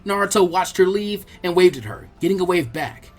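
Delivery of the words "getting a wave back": ordinary delivery, nothing marked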